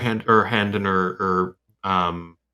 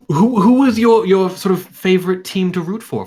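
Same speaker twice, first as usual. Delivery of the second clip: louder, higher eager questions; eager grilling; 8 semitones higher, 6 dB louder than usual. Acted. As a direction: press him for details excitedly. [name] interrogating eagerly.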